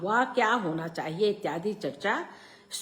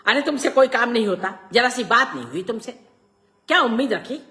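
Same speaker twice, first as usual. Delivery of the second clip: loud, raised-pitch irritated outburst; exasperated scolding; 6 semitones higher, 9 dB louder than usual. A narrator's reading. Father scolding Prakash for coming back empty-handed, exasperated. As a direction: snap in exasperation at a useless helper. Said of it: "सुनकर मैं झल्ला आया".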